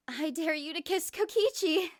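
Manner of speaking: loud, high gleeful shout; yelled dare. Acted. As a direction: shout the dare across the room. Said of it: "she yelled from across the room".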